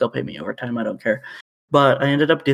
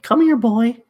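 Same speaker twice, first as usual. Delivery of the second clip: much higher and louder sing-song call; mock dog-calling; 11 semitones higher, 5 dB louder, slower than usual. Mocking the lady, calls the deer like a dog, high and singsong. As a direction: call it like coaxing a puppy.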